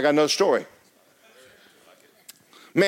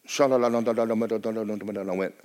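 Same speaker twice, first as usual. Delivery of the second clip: soft, quick sha-la-la babble, mocking fake tongues; 5 semitones lower, 4 dB quieter, faster than usual. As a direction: parody a showy attempt at tongues.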